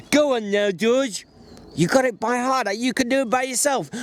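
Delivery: Lenny finishing the familiar lines, overjoyed; Lenny, delighted. A narrator's reading. Lenny urging George.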